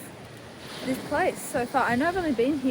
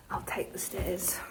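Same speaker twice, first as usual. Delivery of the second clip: whispering; 2 semitones lower, 10 dB quieter than usual. Real, not acted. Whispered aside.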